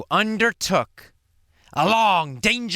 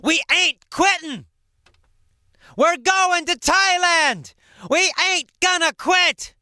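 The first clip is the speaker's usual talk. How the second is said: determined yell; 11 semitones higher than usual. Acted. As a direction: shout it like a penguin who won't give up. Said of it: "Todd yelled".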